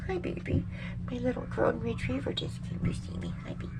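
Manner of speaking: soft, high pet talk; cooing to dog. Real, not acted. Owner to her golden retriever, softly cooing. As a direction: greet a beloved dog softly.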